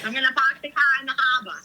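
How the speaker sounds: put-on quick, playful Boston accent. Teasing co-hosts by talking like locals, mock Boston accent. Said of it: "I'm talking like 'em".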